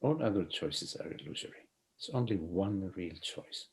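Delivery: hushed, unhurried guiding voice; soft spiritual teaching. Acted.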